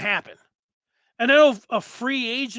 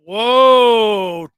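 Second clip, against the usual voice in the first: blown away by the comment; whoa; 11 dB louder than usual. Real, not acted. Host reacting to a listener's claim, loud surprised whoa.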